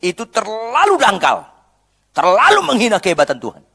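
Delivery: high, loud rebuking sermon; fiery rebuke from the pulpit; preaching at full force. Acted. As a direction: scold the congregation with passion. Preacher rebuking shallow faith.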